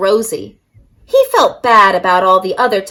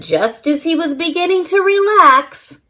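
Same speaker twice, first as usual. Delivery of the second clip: plain reading voice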